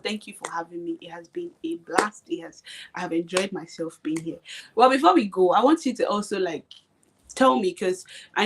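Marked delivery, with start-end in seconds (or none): 0.0-4.4 soft grateful whisper. Real, not acted. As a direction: breathe it out, barely voiced. Thanking host softly.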